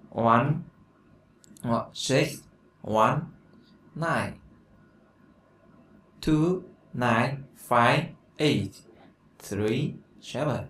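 each digit spaced out, slow and clear; slow dictation. Shown as a demonstration. Teacher dictating a phone number to the class, slow and clear. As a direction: read the number slowly so students can copy it. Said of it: "dí dầu".

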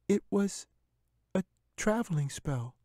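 put-on high young girl's voice; little girl. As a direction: reassure the bear like a frightened little girl. Katy explains.

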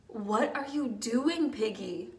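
ordinary delivery

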